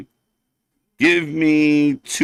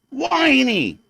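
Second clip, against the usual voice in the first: "Whiny" said higher in a mocking whine; mocking "Whiny" pitched up and dragged; 7 semitones higher than usual. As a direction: whine the word "Whiny" like a brat. Repeating the word whiny.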